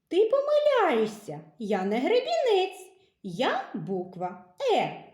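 loud, high, playful verse reading; dramatic poem. Acted. Teacher reads a poem about the comb, loud and playful. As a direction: perform the rhyme with gusto for kids.

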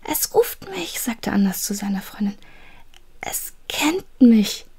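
breathless high whisper, close to tears; a scared boy whispering to his friend; frightened whisper. Acted. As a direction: whisper as if the thing could hear. Anders whispers in terror.

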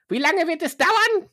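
pushy, wants a price now; impatient broker voice. Acted. Broker continues.

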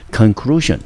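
Conclusion with CLU stressed; stress on second syllable. Shown as a demonstration. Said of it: "重音节落在第二个音节". (重音落在Conclusion的第二个音节)

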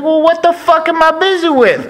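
very high, loud mock-indignant put-on voice; Dusty Rhodes impression. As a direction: act offended in an over-the-top voice. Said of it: "He was like".

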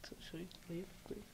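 soft whispered question; whispering. Real, not acted. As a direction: whisper it so nobody hears. Asking quietly during the interview.

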